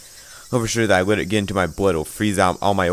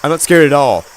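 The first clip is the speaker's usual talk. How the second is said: high, loud panicked denial; frightened shout; 6 semitones higher, 6 dB louder than usual. Acted.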